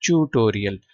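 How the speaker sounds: ट्यूटोरियल with "ch" for the first T, British style; British pronunciation. Shown as a demonstration. Teacher repeating the British form for students, ch-initial ट्यूटोरियल. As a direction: open ट्यूटोरियल on "chew". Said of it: "जो British accent है उसमें".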